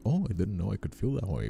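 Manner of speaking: ordinary talk, nothing marked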